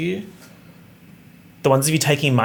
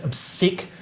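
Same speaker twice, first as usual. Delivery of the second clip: ordinary talk, nothing marked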